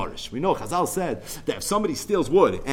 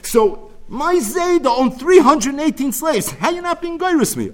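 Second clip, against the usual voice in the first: put-on indignant old woman; 10 semitones higher, 4 dB louder than usual. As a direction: boast about her grandfather, outraged. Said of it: "who's this Zayda she had".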